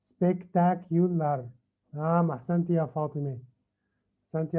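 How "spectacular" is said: "Spectacular" very slowly, spec-tac-u-lar; syllable-by-syllable. Shown as a demonstration. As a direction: stretch "Spectacular" so each syllable can be counted. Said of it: "بنشوف كم مقطع". (نطق "Spectacular" ببطء شديد مقطعًا مقطعًا)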